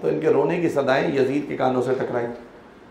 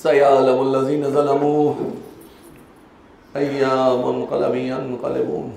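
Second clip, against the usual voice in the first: lower, chanted verse; Quranic recitation; 3 semitones lower than usual. Acted. Closing the majlis with a verse, chanted. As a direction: intone the verse solemnly.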